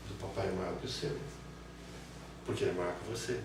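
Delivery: put-on hushed small boy comparing; child, quiet. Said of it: "Aí olha pro pai". (criança comparando em voz baixa)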